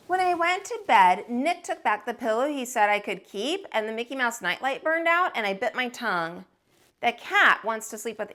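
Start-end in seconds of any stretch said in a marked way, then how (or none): none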